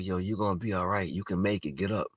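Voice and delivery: quiet coaxing to a hurt dog; soft, soothing encouragement; gently urging an injured puppy to get up; softly coaxing. Retold or imitated. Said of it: "I'm telling the dog".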